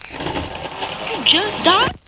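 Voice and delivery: high, slow, loud question; incredulous. Real, not acted. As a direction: shoot up high in sheer disbelief. Disbelief at teammate's game death.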